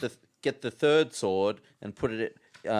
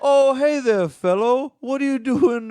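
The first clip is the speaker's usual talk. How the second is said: much higher, friendly, sing-song ghost; bright welcoming voice, pitched up high; cheery ghost voice; 12 semitones higher, 13 dB louder than usual. Acted. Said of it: "you changed the decision about what you were doing with this character".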